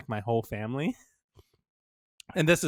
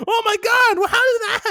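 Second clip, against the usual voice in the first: very high, loud mock alarm; mock horrified shriek; 11 dB louder than usual. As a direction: shriek like a horrified onlooker, hammed-up. Acting out horrified wedding guests.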